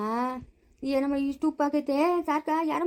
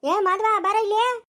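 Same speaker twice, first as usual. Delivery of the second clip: loud, higher call across the yard; calling Madhava over loudly; 7 semitones higher, 5 dB louder, slower than usual. Acted. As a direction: shout for him to come here.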